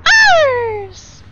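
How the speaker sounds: loud very high shriek of ours; bigger dramatic shout. Acted. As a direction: belt it as the twist.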